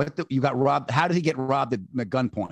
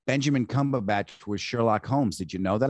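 lower, dull mock voice of his wife; imitating wife; 9 semitones lower than usual. Wife chatting trivia during the movie, lower mock voice. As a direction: lightly drop into a deadpan wife trivia voice. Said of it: "She said".

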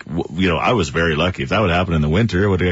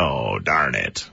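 low, drawn-out imitation of disappointment; mock letdown; 7 semitones lower, 4 dB quieter, much slower than usual. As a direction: let it sink slowly, imitating a let-down caller. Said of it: "He was all so excited, and then he was like".

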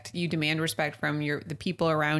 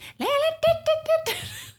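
high-pitched laughter; 4 dB louder than usual. Real, not acted. Laughs at own impression.

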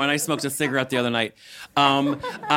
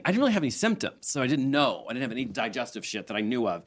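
usual speaking voice

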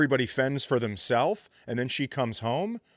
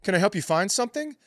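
high, fast chirpy shop-assistant voice; lightly voiced salesclerk; mock perky store clerk; 8 semitones higher, much faster than usual. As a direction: play an eager clerk greeting a customer. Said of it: "they go".

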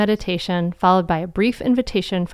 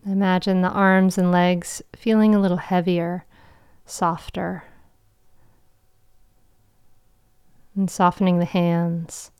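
guided meditation. Acted. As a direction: guide them gently into calm.

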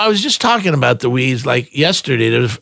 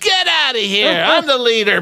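1 bully bandmate; 8 semitones higher than usual. Retold or imitated. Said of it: "He went".

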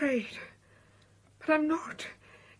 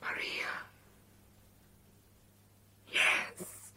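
hushed, accepting the name; whispering; 6 dB quieter, much slower than usual. Acted. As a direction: whisper it to yourself. She accepts her new name.

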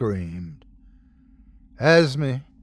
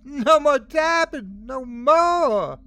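high, manic triumphant shouting; crazed high-pitched glee; gleeful yell; 13 semitones higher, 3 dB louder, slower than usual. A narrator's reading. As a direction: crow it out wildly, stretching the last word. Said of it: "she yelled gleefully".

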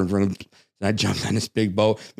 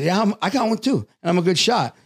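veiled threat; 8 semitones higher than usual. Retold or imitated. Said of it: "but I said it in a way".

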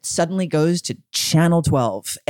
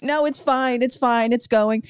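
imitating a friend, much higher; mock reassurance; 11 semitones higher than usual. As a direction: brush it off as fine, hammed up. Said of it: "friend voiced in story".

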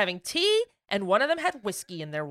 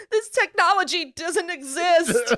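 tripping on drugs; 6 semitones higher, slower than usual. Pretend. Joining the hallucination bit.

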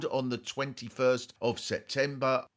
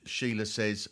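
normal talking voice

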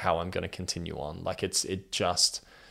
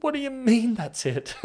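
1 incredulous; 6 dB louder than usual. Retold or imitated. Incredulous at sister's reply.

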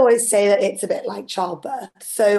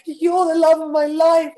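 higher, louder, swooning mock declaration; mock lovesick plea; 8 semitones higher, 5 dB louder than usual. Guest mocking her own unsent texts, swooning and loud. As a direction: ham up a lovesick text. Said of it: "And some of them were like".

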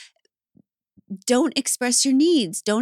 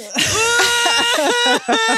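high, loud sobbing laugh; crying laugh; 13 semitones higher, 6 dB louder than usual. Real, not acted. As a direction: let the laugh break into tears. Host overcome, laughing and crying.

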